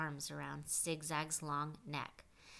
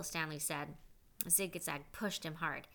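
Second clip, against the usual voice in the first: usual speaking voice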